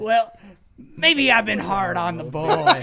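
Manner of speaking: loud, slow, high hammed-up impression; movie father. Imitating the father softening on his son, loud and drawn out. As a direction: belt it out as the father relenting. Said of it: "And he's like".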